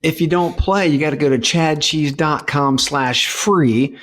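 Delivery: high, slow, hammed-up kids' host; game-show host addressing kids. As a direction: sell it like a cheesy TV host to kids. Pitching the giveaway to listeners.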